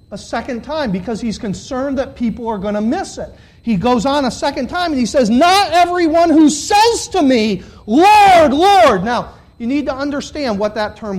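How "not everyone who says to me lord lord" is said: high, loud, preached quotation; ringing pulpit cry; emphatic scripture quote. Acted. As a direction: proclaim it slowly, crying LORD, LORD. Preacher quotes Jesus' warning emphatically.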